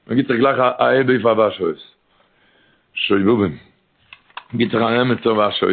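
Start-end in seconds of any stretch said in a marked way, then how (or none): none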